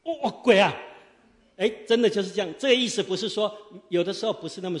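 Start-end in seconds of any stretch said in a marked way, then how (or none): 0.0-0.7 acted fright. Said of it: "那我就在台上表演给我们弟兄姐妹看啊".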